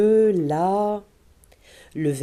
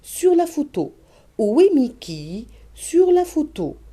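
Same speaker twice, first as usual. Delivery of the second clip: question and answer demo; 5 semitones higher than usual. Shown as a demonstration. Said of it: "on peut poser la question à l'enfant".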